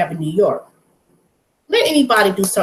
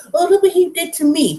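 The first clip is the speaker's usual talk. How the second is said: mock complaining victim; 10 semitones higher than usual. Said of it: "and say".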